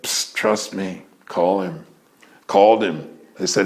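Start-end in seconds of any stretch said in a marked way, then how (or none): 0.0-1.8 softer, lower and slow, conspiratorial; secretive urging. Pretend. A little bird coaxes the speaker to go, hushed and slow. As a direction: lean in and coax him.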